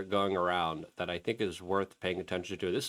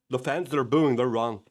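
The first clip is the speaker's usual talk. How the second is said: ordinary talk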